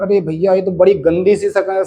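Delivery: ordinary talk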